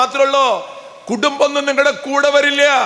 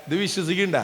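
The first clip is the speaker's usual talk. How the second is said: quiet question; 5 semitones lower, 8 dB quieter, much faster than usual. Shown as a demonstration. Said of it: "അല്ല, ഇത് വിശ്വസിക്കണ്ട?".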